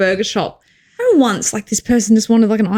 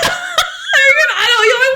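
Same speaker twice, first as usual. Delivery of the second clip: laughing; 13 semitones higher, slower than usual. Real, not acted. Laughing incredulous outburst.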